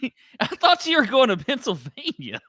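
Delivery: high, loud, laughing protest; laughing disbelief. Real, not acted. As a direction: protest incredulously through real laughter. Laughing disbelief at the friend's story.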